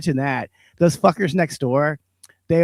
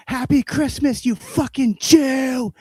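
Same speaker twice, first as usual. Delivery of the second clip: high, loud yelling out the window; retold shout; 9 semitones higher, 5 dB louder, much slower than usual. Yelling at the neighbor from the window, loud taunting shout. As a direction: bellow the insults across the street. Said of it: "ran to the window and said".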